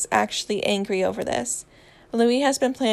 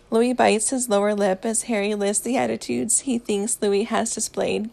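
ordinary delivery, nothing marked

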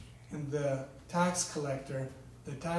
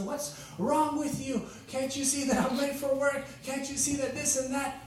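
much higher, irritated mock rant at his wife; angry husband; 10 semitones higher than usual. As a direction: play the rushed, annoyed husband complaining. Husband snaps at wife again.

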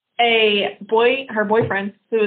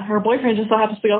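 ordinary talk, nothing marked

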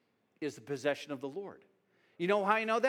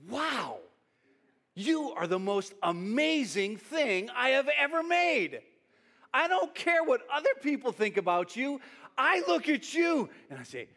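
awed proclamation; 6 semitones higher, 3 dB louder than usual. Acted. God looking at you with delight, awed proclamation. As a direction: proclaim it high, stretching the big words.

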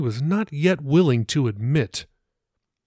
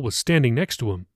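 usual speaking voice

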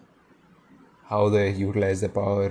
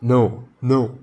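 louder, pleading refusal; crying out; 3 semitones higher, 6 dB louder than usual. A narrator's reading. Pirate cowering before his captain, crying out no. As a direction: cry out in protest.

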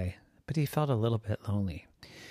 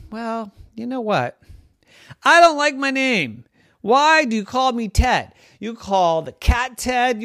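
high, loud exasperated dog voice; the dog lists every pet called Ted; fed-up dog; 13 semitones higher, 10 dB louder than usual. Acted. Dog Ted complains to Salim about everyone's name, exasperated dog.

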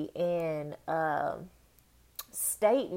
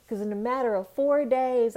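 usual speaking voice

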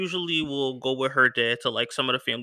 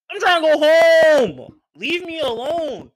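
high, loud and slow, pleading to be left alone; exasperated plea; 13 semitones higher, 9 dB louder, slower than usual. Retold or imitated. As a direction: drag it out, begging to go home. Refusing flirting woman, exasperated.

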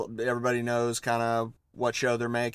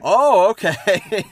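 surprised; 8 semitones higher, 10 dB louder, much slower than usual. Real, not acted. Surprised reaction to challenge.

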